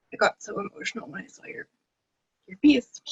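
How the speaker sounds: choked up, words catching in the throat, then a teary laugh; voice breaking with emotion. Real, not acted. Guest telling the artist his piece moved her, voice breaking. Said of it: "I'm getting emotional already".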